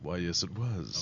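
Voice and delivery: playing up the phone-line seduction; phone-sex voice. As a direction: answer in your phone-sex operator voice. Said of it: "was that your phone sex voice?".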